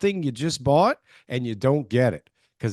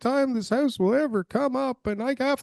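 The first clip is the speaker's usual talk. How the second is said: high, pleading buyer voice, much higher pitched; emotional homebuyer; 10 semitones higher than usual. Retold or imitated. Mocking an emotional buyer who must have the house, high pleading voice. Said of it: "they're trying to buy something really unique".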